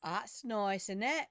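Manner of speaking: put-on lilting accent picked up from TV shows; put-on foreign accent. Streamer slipping into an accent from UK and Australian shows, accidental put-on accent. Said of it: "Sorry about that accent".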